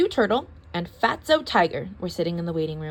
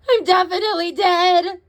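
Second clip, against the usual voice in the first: melodramatic; 9 semitones higher, 7 dB louder than usual. A narrator's reading. Melodramatic insistence.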